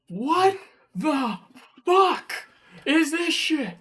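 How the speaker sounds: high, loud, drawn-out incredulous yell; high-pitched retold shout of disbelief; outraged outburst. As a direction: blow up in outrage, breaking up the words. Retelling his outraged reaction to news.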